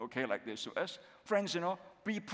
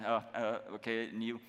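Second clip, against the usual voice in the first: normal talking voice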